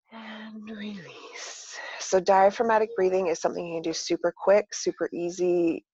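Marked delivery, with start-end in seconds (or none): 0.1-1.8 breathy whisper, drawn out slow; whispered release. Shown as a demonstration. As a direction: let the word out on the breath. Breathing exercise cue.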